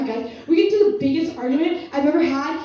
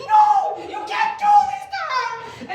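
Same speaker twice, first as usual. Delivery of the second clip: pleading little sister. Retold or imitated. Sister in the corner begging the parents, shrill desperate plea. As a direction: mimic a frantic kid sister begging. Sister defends her in argument.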